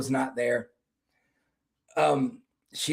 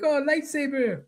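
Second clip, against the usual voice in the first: awed French guy; 11 semitones higher than usual. Retold or imitated. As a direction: say it in high, amazed awe. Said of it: "He was like".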